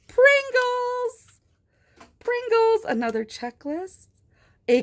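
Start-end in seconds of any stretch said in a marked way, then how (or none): 0.1-2.8 high, delighted naming; excited reveal. Real, not acted. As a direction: announce it with glee.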